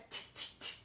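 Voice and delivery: whispered scolding tsk. Acted. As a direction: click the tongue like a mocking monkey. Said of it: "shook their fingers back and said".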